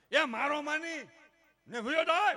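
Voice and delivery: high, loud mock outburst; angry parent. Parent driving the child from home, loud high mock fury. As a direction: order the child out.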